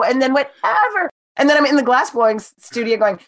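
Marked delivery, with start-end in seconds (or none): none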